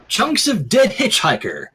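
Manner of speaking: ordinary talk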